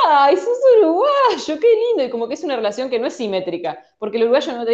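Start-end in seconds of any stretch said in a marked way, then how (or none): 0.1-2.0 high, slow, doting coo; gushing Argentine. Retold or imitated. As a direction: coo over a little brother. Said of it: "Entonces, tipo". (voz empalagosa muy aguda y lenta)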